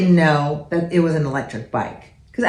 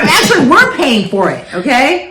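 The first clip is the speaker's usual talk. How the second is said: louder and higher through laughter; laughing retort; 6 semitones higher, 5 dB louder than usual. Retold or imitated. Mom retelling her retort to her daughter, laughing loudly. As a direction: fire it back while cracking up.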